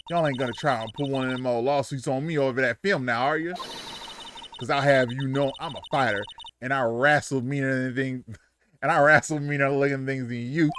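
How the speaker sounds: defensive Southern tough talk; Southern accent, boastful. Acted. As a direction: brag about wrestling in a twang. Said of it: "Why they make her Southern?".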